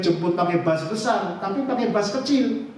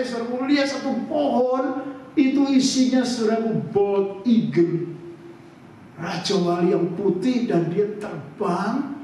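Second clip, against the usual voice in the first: ordinary talk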